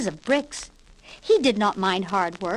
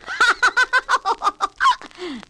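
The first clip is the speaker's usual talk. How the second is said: high, loud giggly laugh; giggling pigs; 13 semitones higher, 4 dB louder than usual. Acted. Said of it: "laughed the two little pigs again".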